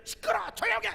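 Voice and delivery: high loud imitated shout; mock yell. Pastor imitating how he'd normally yell at his wife. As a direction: bark it like a short-tempered husband. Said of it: "나는 평상시 같으면 이제".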